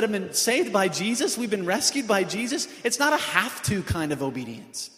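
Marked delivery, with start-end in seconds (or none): none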